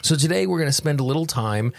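ordinary talk

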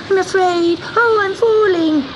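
high, frightened kitten wail; sobbing, afraid. A narrator's reading. Kitten telling the witch he is falling, sobbing high. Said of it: "sobbed Gobelino".

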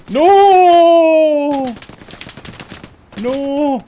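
long, high-pitched howl of dismay; wailed no. Real, not acted. Commentator watching a disaster, high dismayed wail. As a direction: cry out high and drawn out.

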